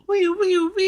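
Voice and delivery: very high imitated siren; high-pitched "woo" siren noise; siren wail. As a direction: sign off with a squealed alarm noise. Siren jingle closing mock segment.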